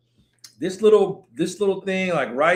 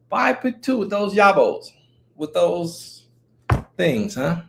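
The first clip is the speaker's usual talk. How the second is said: normal talking voice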